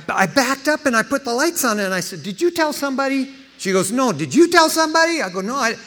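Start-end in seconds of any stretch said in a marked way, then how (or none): 2.2-5.7 high, louder astonished question; stunned, pressing for an answer; amazed. Retold or imitated. Stunned asking wife.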